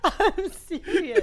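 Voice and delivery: high, slow protest through laughter; laughing protest. Real, not acted. Insisting to co-host after the moan, laughing protest. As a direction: insist while helplessly cracking up.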